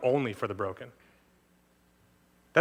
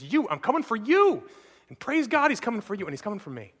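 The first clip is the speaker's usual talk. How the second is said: raised exclamation of promise; fervent preaching; 7 semitones higher, 4 dB louder than usual. Acted. Pastor assuring the broken, fervent preaching. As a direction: cry out the promise with joy. Preaching climax, praise God.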